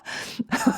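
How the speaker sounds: soft whispered giggle; breathless giggle. Real, not acted. After her counting demo, a breathy giggle. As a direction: let a quiet giggle slip out. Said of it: "Oh my goodness".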